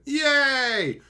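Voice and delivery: high, loud gleeful cheer; mock cheer. Cheering in the theater as Pepper falls, loud high mock cheer. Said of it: "and I went".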